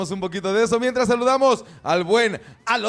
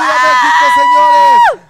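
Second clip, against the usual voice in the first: grito de euforia; 15 dB louder than usual. Real, not acted. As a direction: shout at full power, very high. Celebran el triunfo de México. (grito eufórico de celebración)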